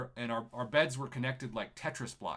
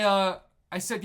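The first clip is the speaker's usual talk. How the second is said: ordinary talk, nothing marked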